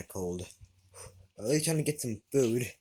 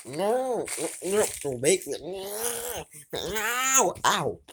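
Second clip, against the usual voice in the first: panicked pleading; 8 semitones higher, slower than usual. Acted. Cat begging its owner not to make it go outside, high frantic pleading. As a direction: beg not to be pushed out, panicking.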